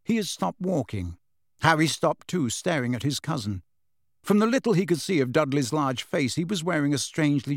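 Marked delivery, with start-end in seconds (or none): none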